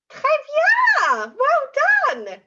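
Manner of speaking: loud, high, thrilled cheer; big high-pitched well done; delighted praise. Real, not acted. Praising children after their attempt.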